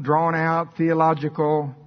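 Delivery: slow, stretched-out words; mock long-winded delivery; drawn-out. Shown as a demonstration. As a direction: drag the words out. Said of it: "It doesn't have to be long".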